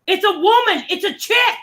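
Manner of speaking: high-pitched mock teen protest; yelling daughter. Daughter arguing with her mother on webcam, imitated high yelling. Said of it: "her daughter's just yelling at her".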